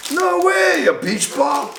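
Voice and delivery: high-pitched excited disbelief; real high, thrilled surprise; delighted surprise. Real, not acted. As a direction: squeal it out, amazed by the gift.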